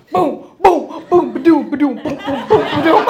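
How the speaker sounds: mock heartbeat thumping; pulsing boom sounds. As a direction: beat out the pulses like a drum, giggling. Imitating genital pulses with laughter.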